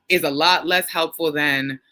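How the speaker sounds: ordinary talk, nothing marked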